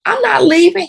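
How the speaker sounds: high, firm refusal; retold defiant refusal. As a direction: plant your feet and refuse, pitched high. Refusing to leave the show.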